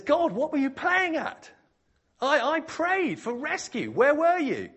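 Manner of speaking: high, loud acted protest; hammed-up outraged wail; indignant complaint. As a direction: demand answers from God, aggrieved. Drowned man confronts God.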